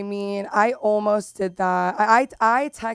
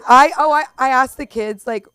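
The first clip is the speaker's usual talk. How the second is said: normal talking voice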